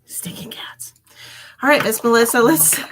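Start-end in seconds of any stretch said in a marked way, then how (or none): 0.0-0.9 whisper. Real, not acted. Grumbling about the cats.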